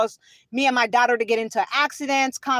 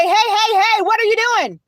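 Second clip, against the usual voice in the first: higher-pitched mock police challenge; officer calling out; 6 semitones higher, 4 dB louder than usual. Police officer running up to a stopped driver, imitated urgent call-out.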